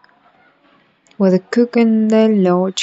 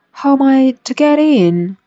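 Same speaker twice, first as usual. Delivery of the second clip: raised voice, insistent; louder; 6 semitones higher, 5 dB louder than usual. A narrator's reading. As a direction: ask it louder, a bit impatient. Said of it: "asked Alice again in a louder tone".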